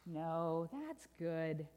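low, deliberate contradiction; knowing correction. Acted. Storyteller overturns bad news, low and deliberate. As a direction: break the good news gravely.

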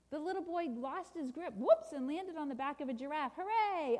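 animated storybook narration; children's story reading. A narrator's reading. Preacher reads a picture book to listeners, animated storytelling. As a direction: read it to children with lively emphasis.

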